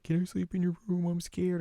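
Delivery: put-on high-pitched timid kid voice; scared little brother. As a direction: plead like a frightened small boy. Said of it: "Sota comes in and is like".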